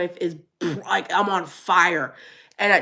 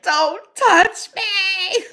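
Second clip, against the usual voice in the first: much higher, slow drawn-out mock yell; 12 semitones higher, 5 dB louder, much slower than usual. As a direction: stretch it out in a playful higher cry. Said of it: "now I'm like".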